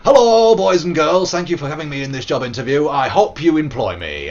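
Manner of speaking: silly character shouting at interviewers; put-on interview voice. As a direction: bellow a hammed-up greeting. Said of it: "I'm gonna say".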